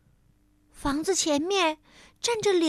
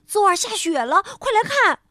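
higher and louder, bursting with news; excited call; 8 semitones higher, 5 dB louder than usual. A narrator's reading. Rabbit waking his sleepy friend about the snow, eager call. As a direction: wake him with eager news.

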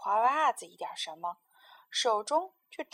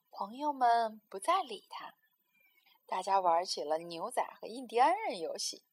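plain reading voice

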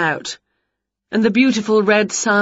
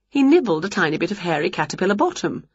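plain reading voice

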